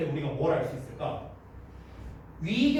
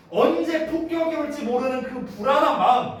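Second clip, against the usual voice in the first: raised and louder emphasis; emphatic stress; 6 semitones higher, 4 dB louder than usual. Shown as a demonstration. Teacher to students, pressing the key point with emphasis. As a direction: lean into the key idea.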